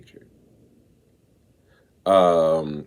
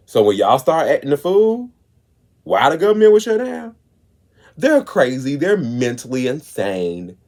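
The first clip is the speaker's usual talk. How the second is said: raised, mocking voice aping the critics; mocking their claims; 6 semitones higher, 4 dB louder than usual. Mocking critics to the audience, raised and scornful. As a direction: ham up what they say about you.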